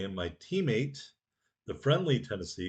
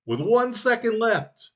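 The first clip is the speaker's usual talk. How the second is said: much higher, louder hype; excited; 10 semitones higher, 8 dB louder than usual. Real, not acted. Gamer calling his last-second kill to viewers, thrilled.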